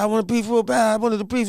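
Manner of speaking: high, urgent pleading answer; desperate dude. Retold or imitated. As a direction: beg like you nearly drowned. Dude gasping answer to guru.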